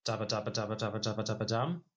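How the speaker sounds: fast mouthed drumroll; vocal drum roll. Pretend. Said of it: "drum roll, please".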